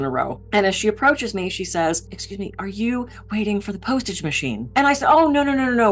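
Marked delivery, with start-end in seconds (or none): 2.1-4.7 soft, timid polite question; quiet, shy. Retold or imitated. Shy woman asking a stranger in line, quietly. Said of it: "she seems very quiet". 5.1-5.9 higher, hurried string of denials; hasty reassurance. Retold or imitated. Speaker correcting a shy woman in line, hastily.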